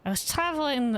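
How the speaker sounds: rejected take, slipping into an Irish accent; too Irish. Shown as a demonstration. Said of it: "not like that. That's gonna be too Irish".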